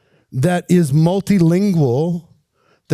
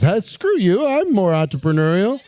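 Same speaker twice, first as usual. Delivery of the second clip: higher-pitched playful protest; lightly acted comeback, pitched up; mock indignation; 5 semitones higher than usual. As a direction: object like a jokingly offended rival. Acted rival objecting in the group.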